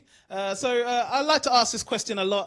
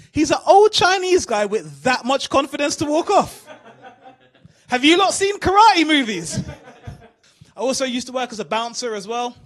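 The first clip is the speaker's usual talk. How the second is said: normal talking voice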